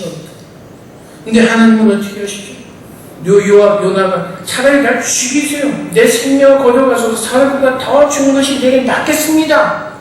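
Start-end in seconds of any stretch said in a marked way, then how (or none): 4.4-9.9 higher, whiny sulking prophet; Jonah complaining bitterly. Retold or imitated. Jonah sulks and begs God to take his life, higher and whining. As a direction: throw it at God like a sulking child. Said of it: "심하게 컴플레인 하는데".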